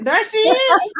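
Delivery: high, loud, laughing greeting; a big squealing hello as she appears; excited welcome. Real, not acted.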